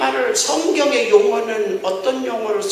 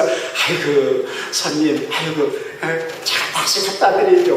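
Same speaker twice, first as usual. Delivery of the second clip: fawning waiter; 6 semitones lower than usual. Retold or imitated. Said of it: "아주 막 굽실굽실하면서".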